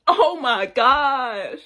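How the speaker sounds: drawn out, slow and loud; imitating a friend screaming in pain; mock drunk wail. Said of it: "she just keeps screaming".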